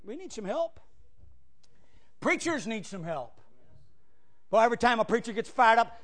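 normal talking voice

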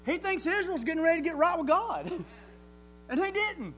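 loud, rising preacher's call; preaching at full force. Real, not acted. Preacher urging his church with full-force preaching. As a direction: drive it home to the congregation. Preaching to congregation about Elijah.

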